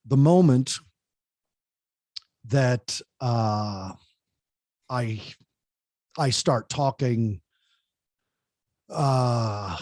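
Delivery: deliberately sluggish, halting delivery; slow droning demo. Shown as a demonstration. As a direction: drag it out so they tune out. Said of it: "keep the pace of your voice up".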